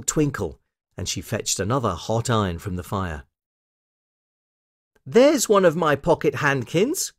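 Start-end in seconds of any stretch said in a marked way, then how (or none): 5.0-7.1 put-on little girl's high, delighted discovery; excited cry. A narrator's reading. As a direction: cry out on spotting your lost things. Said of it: "cried Lucy".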